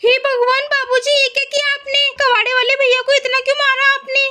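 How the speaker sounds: high, dismayed reproach; shocked daughter-in-law. Acted. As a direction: question an elder in acted horror. बहू पिटाई देखकर हैरान. (हैरान, परेशान बहू की आवाज़)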